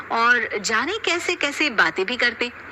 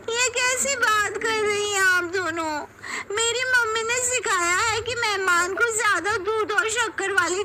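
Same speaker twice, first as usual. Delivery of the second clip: put-on higher young women, lightly whiny; bickering bahus; 9 semitones higher than usual. Daughters-in-law arguing over the tea, high and lightly whiny. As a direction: argue over how to make tea.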